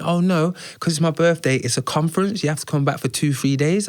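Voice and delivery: hint of a pleading friend; mock friend's insistent voice; imitating his friend. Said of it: "she went".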